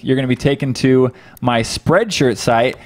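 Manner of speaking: usual speaking voice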